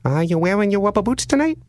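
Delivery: high, sing-song creepy caller voice; odd prank caller. Retold or imitated. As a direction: play the weird caller way up high. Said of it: "He goes".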